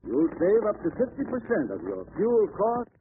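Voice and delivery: ordinary talk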